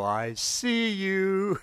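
high, slow sing-song I see you; playful seek call. Retold or imitated. Father teasing hidden toddler Heather, high playful sing-song. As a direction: tease the hiding child in a high drawn-out call.

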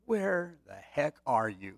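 slow, stern mock scolding; a hint of an exasperated dad calling out; frustrated father. Frustrated father scolding a late child, mild mock anger. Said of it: "a father who might get frustrated".

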